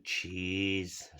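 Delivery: normal talking voice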